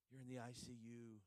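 ordinary talk, nothing marked

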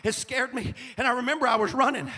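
high, loud climactic sermon shout; shouted preaching. Acted. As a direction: build to a roaring climax. Preaching full force, shouting.

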